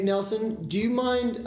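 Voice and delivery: ordinary delivery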